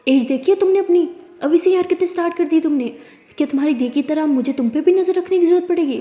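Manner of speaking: put-on furious father scolding Pari about boys; angry scolding. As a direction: lay into her with the shouting. Father scolding daughter in anger.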